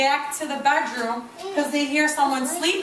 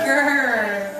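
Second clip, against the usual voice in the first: put-on a low playful growl, shown to kids; mock bear growl; 4 semitones lower than usual. Shown as a demonstration. Teacher showing the class how Mommy Bear growled, mock growl. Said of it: "How did she growl".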